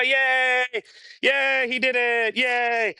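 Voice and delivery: high, slow delighted cheer. Real, not acted. Trainer cheering the puppy for climbing stairs, high delighted cheer. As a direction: celebrate the puppy's win.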